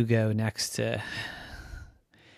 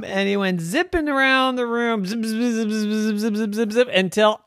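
high-pitched, zippy sound effects; excited narration; 10 semitones higher, 5 dB louder than usual. A narrator's reading. Narrator follows Rocky around the room, excited. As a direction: keep it high and buzzing along.

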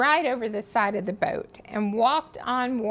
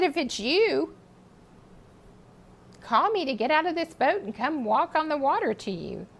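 higher, bold and eager; faith spoken out loud; 4 semitones higher than usual. Retold or imitated. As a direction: call out with daring faith. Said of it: "He spoke faith out loud".

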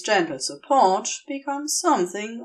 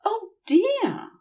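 Aziraphale's voice; 13 semitones higher than usual. A narrator's reading.

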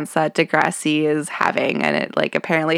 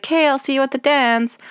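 Ashley impression; 7 semitones higher, faster than usual. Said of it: "Ashley's like".

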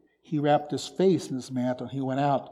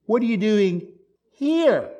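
HERE higher, louder, set apart by a pause; stress on HERE; 5 semitones higher, 4 dB louder than usual. Shown as a demonstration. Preacher points the congregation to God's own location, stress on HERE. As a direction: lean hard on "here", pitched high and falling. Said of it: "What does that imply?".

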